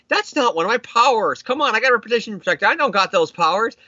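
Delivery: high, indignant protest; mock offended. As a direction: protest as if your reputation were insulted. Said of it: "then Peter gets really offended".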